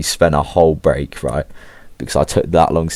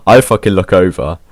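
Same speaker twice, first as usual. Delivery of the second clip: ordinary talk, nothing marked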